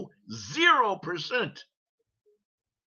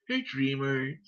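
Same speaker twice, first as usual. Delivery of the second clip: mocking sneer; 6 semitones lower, 7 dB quieter than usual. Mocking repeat.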